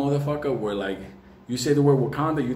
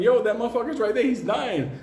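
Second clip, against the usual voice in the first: urgent yell; 9 semitones higher than usual. Retold or imitated. Yelling to fireman about friend.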